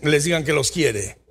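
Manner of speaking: usual speaking voice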